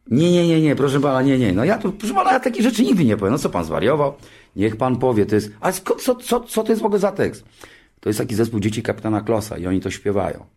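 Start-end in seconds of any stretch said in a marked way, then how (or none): 0.1-3.3 horrified refusal. Retold or imitated. The actor refuses the host's silly slogan, high alarmed protest. 5.6-7.4 squeaky, much higher scoffing voice; mock indignation. The actor scoffs at the band's lyric to the host, squeaky mock outrage.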